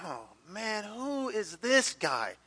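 soft, slow, dismayed mutter to himself; a retold worried aside, low and slow; hushed inner thought. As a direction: quietly dread it under your breath. Inner thought while sitting head down.

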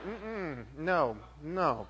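low, soft, curt refusal; a hint of a mother saying no; stern German mom. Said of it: "She's like".

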